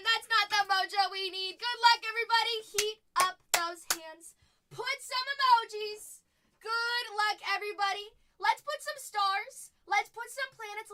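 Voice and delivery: loud, high hype for the chat. Real, not acted. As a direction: pump up the viewers. Hyping up the chat.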